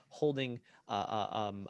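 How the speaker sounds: usual speaking voice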